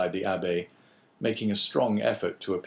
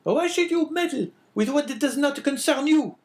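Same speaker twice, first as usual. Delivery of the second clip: much higher, louder, harsh woman's voice; sickly scolding wife; 6 dB louder than usual. A narrator's reading. Wife calling down the stairs at husband, scolding. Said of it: "said a voice from the top of the stairs".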